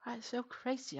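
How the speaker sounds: talking through a yawn; sleepy yawn while speaking. Real, not acted. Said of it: "I'm yawning today".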